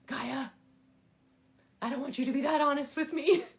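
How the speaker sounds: loud, high comic complaint; mock protest. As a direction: protest in playful exasperation, pitch climbing. Mock protest to her card oracle.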